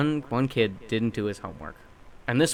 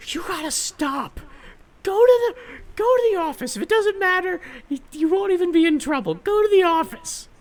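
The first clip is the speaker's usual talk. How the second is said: high-pitched mock yell of a fed-up teacher, much louder; shouting teacher; 5 dB louder than usual. Fed-up teacher shouting at the boy to go to the office, high mock yell. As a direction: squeak it out like a frazzled teacher losing patience. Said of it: "the teacher's shouting at him".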